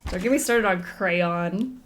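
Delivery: normal talking voice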